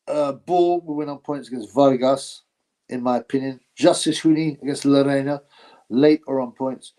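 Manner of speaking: normal talking voice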